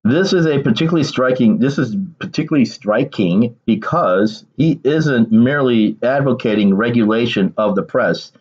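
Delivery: ordinary delivery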